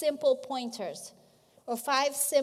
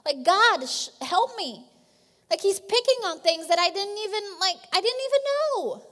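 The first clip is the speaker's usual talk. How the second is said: rising, louder, ever more frantic complaint to God; voicing a harassed worker crying to God, higher and louder; desperate prayer; 4 semitones higher, 5 dB louder than usual. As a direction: pour out a raw plea, climbing higher to the end. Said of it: "It's like, honest raw prayer".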